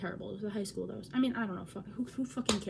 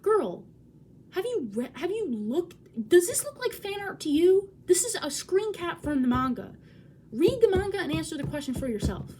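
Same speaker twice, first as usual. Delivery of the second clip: raised, higher exasperated lecture; sassy retort; 7 semitones higher, 5 dB louder than usual. Retold or imitated. Telling a friend what she should have said, exasperated and louder. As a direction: talk down to her like it's obvious. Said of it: "I shouldn't have said to her".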